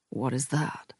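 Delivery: soft question that fades away; trailing off. A narrator's reading. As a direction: let the voice trail off to nothing. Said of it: "His voice trails off".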